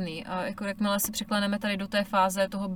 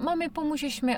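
put-on child asking; 7 semitones higher than usual. Child voiced asking mom.

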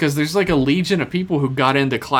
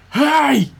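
high, loud yell; shouting capitalized words; 13 semitones higher, 4 dB louder than usual. Shown as a demonstration. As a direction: bark it like a football cheer. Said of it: "so I assume I'm supposed to yell it".

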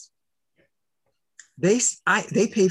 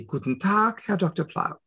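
German reply; 4 semitones higher than usual. Retold or imitated. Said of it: "and I say".

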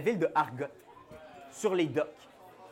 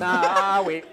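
dock worker voice; 2 semitones higher, 4 dB louder than usual. Acted.

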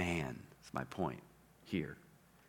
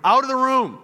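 high, loud royal command; commanding proclamation; 14 semitones higher, 14 dB louder than usual. Retold or imitated. Joseph clearing the room for his brothers, a high loud command. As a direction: order everyone out of the room. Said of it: "like he was just making this proclamation".

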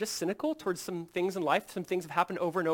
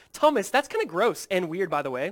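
raised, louder, put-on complaining; mock frustration; 4 semitones higher, 6 dB louder than usual. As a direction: act out a disgusted, impatient telling-off. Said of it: "He's not frustrated. He's not going".

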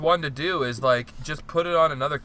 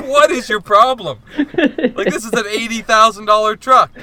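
higher, louder mock outrage; exasperated yell; 7 semitones higher, 10 dB louder than usual. Truck owner yelling about his expensive truck, in higher, louder mock outrage. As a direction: rant about the pricey truck. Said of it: "And he was like".

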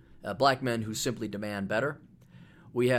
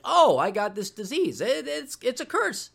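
mock dismayed patient; 10 semitones higher, 4 dB louder than usual. Voicing a diagnosed person.

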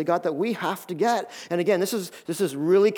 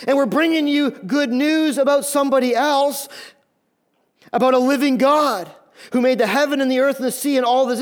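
crying out; 6 semitones higher, 4 dB louder than usual. Retold or imitated. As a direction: plead with the crowd at full voice. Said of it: "crying this".

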